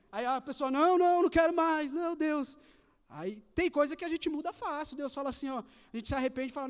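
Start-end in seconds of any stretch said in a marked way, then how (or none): 0.7-2.6 high, loud, panicked pleading; panicked refusal. Retold or imitated. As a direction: beg your way out of death. Panicked refusal near death. (recusa em pânico, alta e aguda)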